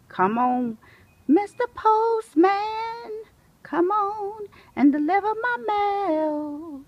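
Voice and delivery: high, loud call to the postman, slow; hollering down the street for the mailman. Real, not acted. Calling out to the postman.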